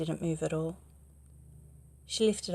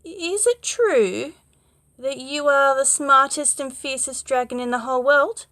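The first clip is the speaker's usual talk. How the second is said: sly flattery; 7 semitones higher, 9 dB louder than usual. Acted. Princess flatters the dragon.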